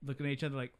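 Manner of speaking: ordinary delivery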